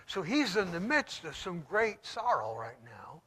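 normal talking voice

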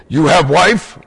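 lightly put-on Russian accent; Russian guy. Teacher imitating a Russian who drops articles, light Russian accent. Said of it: "Like a Russian guy might say".

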